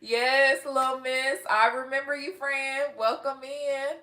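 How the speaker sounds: put-on Texas country accent. Shown as a demonstration. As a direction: greet a friend in a playful country twang. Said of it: "This is my country accent".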